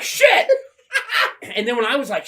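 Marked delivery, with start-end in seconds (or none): 0.0-0.6 high, loud panicked blurt; startled yelp. Retold or imitated. Recalling his own panic at the groundhog, a high loud yelp. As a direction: yelp it like you just got scared.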